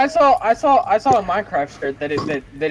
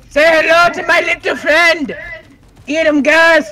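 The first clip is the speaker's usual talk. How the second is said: much higher, louder mock gangster; movie villain shout; 12 semitones higher, 7 dB louder than usual. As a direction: yell it like Scarface. Movie quote shouted in game.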